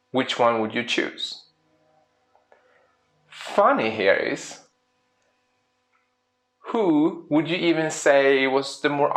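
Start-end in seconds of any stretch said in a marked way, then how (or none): none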